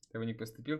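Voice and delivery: usual speaking voice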